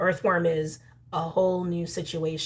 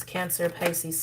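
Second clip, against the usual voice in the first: ordinary delivery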